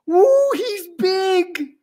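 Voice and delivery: very high, loud, slow squeal; swooning admirer. A narrator's reading. Gidget sees Gordon's display, swooning squeal. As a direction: swoon over him.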